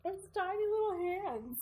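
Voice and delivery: high mock-politician voice; hammed-up tiny-hands parody; Trump. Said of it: "a whole thing with tiny hands".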